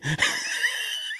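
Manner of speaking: a high, delighted laugh bursting out; sudden high laugh breaking out; big burst of laughter. Real, not acted. Laughs at own imagined thoughts.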